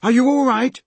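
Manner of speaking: high, loud worried call; shout. Acted. Peter checks on hurt Molly, high worried shout. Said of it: "shouted Peter".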